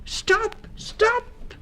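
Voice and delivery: high, sharp cry of stop. A narrator's reading. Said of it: "cried Missus Town Mouse".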